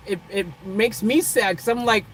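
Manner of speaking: ordinary delivery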